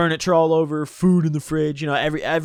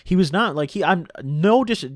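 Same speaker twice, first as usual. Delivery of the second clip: ordinary delivery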